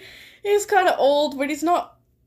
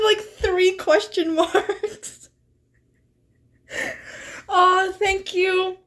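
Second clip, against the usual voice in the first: high-pitched, slow, breaking into laughter; can barely get the words out for laughing; laughing while telling; 5 semitones higher than usual. Real, not acted. Streamer recounting her own prank line, laughing. Streamer cracking up reading chat.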